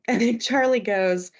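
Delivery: ordinary talk, nothing marked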